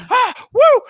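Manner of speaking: very high, very loud whooping yell; mock battle whoop. Imitating a teammate whooping mid gunfight, very loud high whoop. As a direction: let out a hyped war whoop. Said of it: "keeps yelling into the mic".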